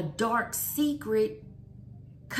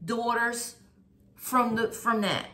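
fiery preacher calling out; preaching; 5 dB louder than usual. Acted. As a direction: preach it with full force. Preaching a call to come out.

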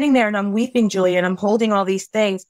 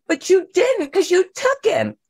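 accusing protest; 10 semitones higher than usual. Retold or imitated. Arguing back with God.

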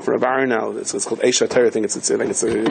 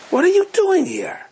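sharply higher, accusing question; indignant demand; slower than usual. Retold or imitated. Innkeeper catching the poor Yid in the corner, high and indignant. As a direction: snap at the intruder in a high voice.

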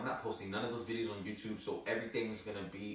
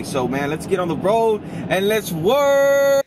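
loud pumped-up rallying, louder throughout; hype shout; 3 semitones higher, 15 dB louder than usual. Real, not acted. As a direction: fire up the crowd for the year. Hyping up the new year.

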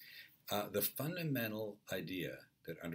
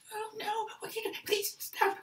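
very high, pleading squeal; terrified old lady; 6 dB louder than usual. Acted. Old lady begs oncoming truck driver, high-pitched panic.

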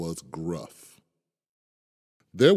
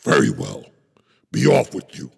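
low, louder troll growl; 6 semitones lower, 6 dB louder, faster than usual. Acted. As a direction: dismiss it like a gruff troll. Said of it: "said the troll".

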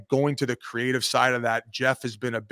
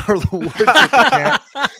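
laughing through the words, higher and louder; 10 semitones higher, 10 dB louder than usual. Real, not acted. Telling buddies the golf story, laughing hard. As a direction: crack up while telling it. Laughing retelling of cameraman ambush.

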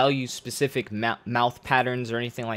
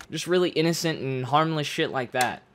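usual speaking voice

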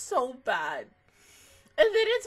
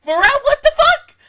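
higher, louder disbelieving yell; retold outburst; 10 semitones higher, 16 dB louder than usual. Fan retelling the game's ending to viewers, disbelieving yell. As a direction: yell it like you can't believe the ending. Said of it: "and you're like".

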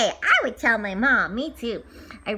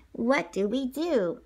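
ordinary delivery

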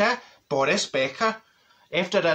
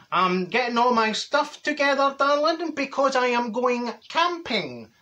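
slow, sing-song camp voice rising high on darling; hammed-up luvvie neighbour, drawn out; 6 semitones higher than usual. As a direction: neighbour showing off his foil blanket, camp and slow. Neighbour quoted announcing camping.

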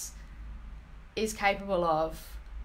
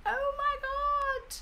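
amazed; much slower than usual. Real, not acted. Amazed by the results.

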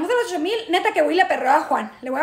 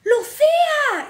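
drawn-out, high holler of a name; mock shout; 9 semitones higher, 4 dB louder, much slower than usual. Stranger yelling at woman outside the club, high drawn-out shout. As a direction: call out "Lucía" high, stretching it long. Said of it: "y de repente gritan".

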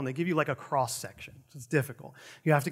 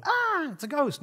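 mock fright; 9 semitones higher than usual. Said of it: "like the disciples in the boat".

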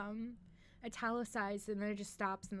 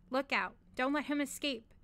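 higher, louder cry of alarm; urgent warning; 4 semitones higher, 8 dB louder than usual. Acted. Warning companion as the fox races out, urgent cry. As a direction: call out a sudden warning.

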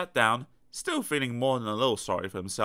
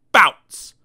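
loud bounce call; 5 semitones higher, 14 dB louder than usual. A narrator's reading. Bounce sound effect.

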